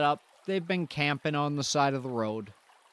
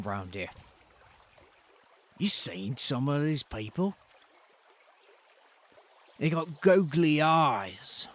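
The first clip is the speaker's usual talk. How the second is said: lightly Cockney; 4 semitones lower than usual. Acted. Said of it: "we're going for that accent this time".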